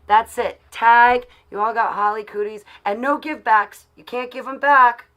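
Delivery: loud teasing playground chant; playful tag. Pretend. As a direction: call it out like a kid playing tag. Playground tag game with viewers.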